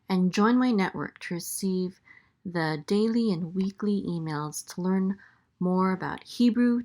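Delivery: promo read. Acted. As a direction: pitch the website to listeners. Ad read closing.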